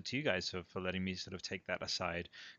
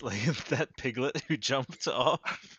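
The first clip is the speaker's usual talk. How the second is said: laughing; 4 semitones higher, 7 dB louder, much slower than usual. Real, not acted. Mimicking co-host, laughing.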